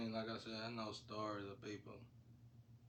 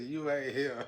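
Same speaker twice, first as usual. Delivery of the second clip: loud quote breaking into laughter; laughing taunt; 5 semitones higher, 10 dB louder, slower than usual. Retold or imitated. Retelling the fight, a laughing loud taunt. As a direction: tell him off through a laugh.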